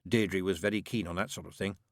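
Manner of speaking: usual speaking voice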